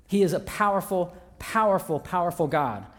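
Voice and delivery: each "powerful" hit with a fall, building to "powerful God"; "powerful, powerful, powerful" stressed and falling. Real, not acted. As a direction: pound "powerful" three times like a preacher. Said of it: "repeated word for emphasis".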